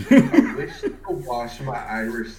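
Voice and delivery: slow, loud, breaking into laughter; laughing through it. Real, not acted. Tripping on a tongue twister, laughing loud. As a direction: try the twister and crack up. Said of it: "I didn't know that was gonna get me".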